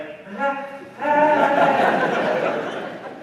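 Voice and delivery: high, loud forced nervous laugh; fake laugh. Shown as a demonstration. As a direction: laugh it off, unsure if he's joking. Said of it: "And you do that fake".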